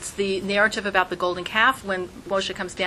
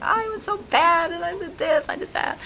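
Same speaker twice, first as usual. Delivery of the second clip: put-on childlike confession; 9 semitones higher than usual. Child confessing to loving father.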